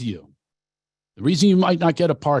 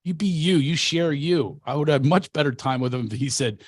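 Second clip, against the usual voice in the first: ordinary talk, nothing marked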